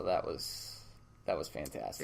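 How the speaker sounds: understate it with dry sarcasm; deadpan sarcasm. Real, not acted. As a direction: let it drop, drily ironic. Sarcasm about driving into river.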